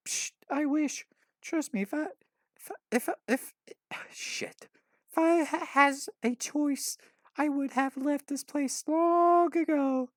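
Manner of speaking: put-on stumbling high girl voice, bitter; sarcastic girl voice. A narrator's reading. Orphan girl telling Mitch she wishes, sarcastic high voice. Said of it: "She laughs sarcastically".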